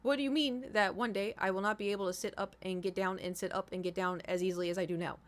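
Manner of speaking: usual speaking voice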